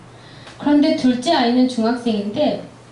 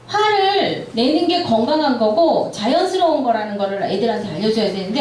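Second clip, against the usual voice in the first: usual speaking voice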